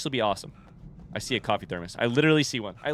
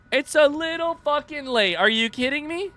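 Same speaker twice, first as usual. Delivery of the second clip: exasperated disbelief; 12 semitones higher than usual. Real, not acted. Player protesting a too-late warning, exasperated disbelief. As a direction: wail it high in disbelief.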